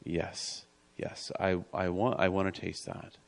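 breathless, hushed giving in; craving whisper. Pretend. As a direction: whisper it like a tempted addict. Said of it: "did you give in to it and go".

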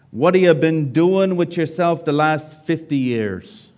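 put-on drawn-out Brooklyn accent with some Irish. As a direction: ask it like an Irish Brooklynite, slowly. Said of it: "a little Irish Brooklyn in there".

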